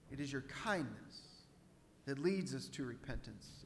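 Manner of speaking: soft, low, earnest prayer. Real, not acted. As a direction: pray gently, letting each phrase settle. Leads a closing prayer.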